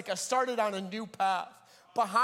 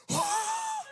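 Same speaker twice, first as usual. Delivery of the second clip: very high, thin breath of a scream; imitated voiceless terrified shriek; airy scream. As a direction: scream with nothing but air. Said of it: "scream so loud, but it's just air".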